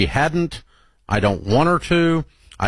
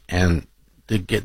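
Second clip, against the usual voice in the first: ordinary delivery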